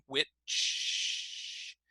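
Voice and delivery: WHICH with its CH stretched into a long hiss; held ch. Shown as a demonstration. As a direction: start "which" and hold the final ch on and on. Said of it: "start with the first sound".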